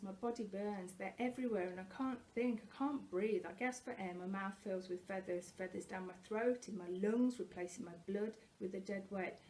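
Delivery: ordinary delivery, nothing marked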